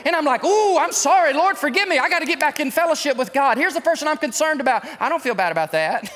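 high, loud, driving preacher voice; preaching at full force. Acted. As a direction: preach it out to the whole church.

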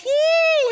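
loud, high, drawn-out whoop; excited whoop. Retold or imitated. Cashier handed money by a customer, excited whoop. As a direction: imitate his thrilled holler. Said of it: "He got excited".

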